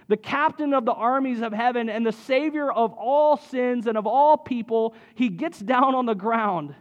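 rising fervour through the list of titles; building preaching. Acted. Pastor to congregation, fervent preaching.

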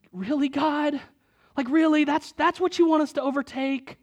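high-pitched disbelieving question; incredulous. Retold or imitated. Voices Israelites' disbelief at Jericho.

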